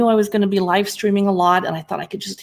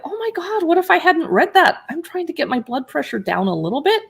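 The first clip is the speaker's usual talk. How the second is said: high-pitched retold dismay, easing down; mock alarm; 8 semitones higher than usual. Streamer retelling her reaction to the sodium label, mock alarm. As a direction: replay a startled realization, starting high. Acts out own shocked reaction.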